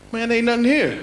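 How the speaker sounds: high-pitched cartoon tumbleweed; high little character voice; talking tumbleweed. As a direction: squeak it out as a passing tumbleweed. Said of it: "Tumbleweed would roll up to the town and be like".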